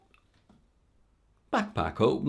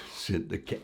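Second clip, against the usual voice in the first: usual speaking voice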